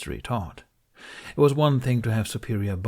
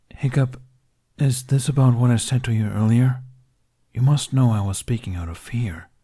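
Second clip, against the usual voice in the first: louder, urgent and sincere; earnest apology; 4 semitones higher, 6 dB louder than usual. Acted. Dragon apologising to Hiccup for speaking out of fear, urgent. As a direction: press the apology home.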